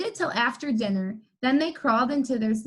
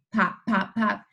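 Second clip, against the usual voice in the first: plain reading voice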